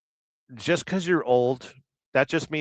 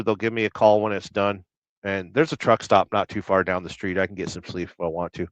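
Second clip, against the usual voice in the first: ordinary delivery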